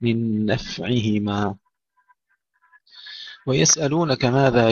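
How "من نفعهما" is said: نفعهما slowly, with a hissed, unbounced ف; slow, breathy F. Shown as a demonstration. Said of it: "الفاء فيها همس وليس فيها قلقلة".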